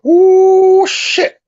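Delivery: high, loud "ooh" falling away; mock holler of dismay; imitated hollering. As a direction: holler it high, then drop off. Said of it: "He come back hollering".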